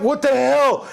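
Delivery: very high, loud disbelief; shocked outcry. Retold or imitated. Dad reacting to his cursing daughter, loud shocked outcry.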